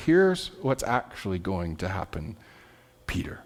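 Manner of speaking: low, soft, sobering warning; grave correction. Acted. Jesus telling Peter what will really happen, grave and quiet.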